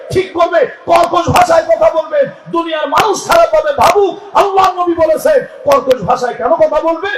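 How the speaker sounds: high, loud shouted sermon; fiery preaching. Acted. Preacher urging women to speak harshly, shouting high. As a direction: drive the rule home in a high shout.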